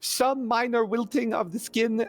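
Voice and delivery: high, swooping salesman-like character voice; zealous Nibbles, eager crank. Acted. Nibbles selling pig's blood to Lady Taryn, fussily enthusiastic. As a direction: pitch it eagerly, dropping away at the drawbacks.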